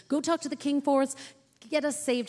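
imitating an insistent plea; urgent pleading. Acts Mordecai begging Esther for help.

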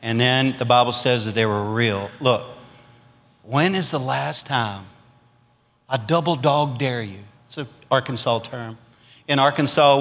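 usual speaking voice

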